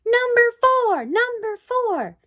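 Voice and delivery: high, loud, drawn-out cheer; crowd shouting. A narrator's reading. Crowd cheering runner number four, loud high chant. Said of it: "the people began to shout".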